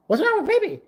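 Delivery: high, indignant mock question; high mock waitress voice; offended waitress. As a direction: squeak it like a put-out server.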